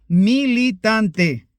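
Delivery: "militante" slowly spaced out, syllable by syllable; syllable-by-syllable stress. Real, not acted. Preacher naming the church of Satan's preachers, "militante" spelled out slowly. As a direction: draw out each syllable of "militante". Names the false church pointedly.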